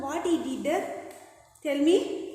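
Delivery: usual speaking voice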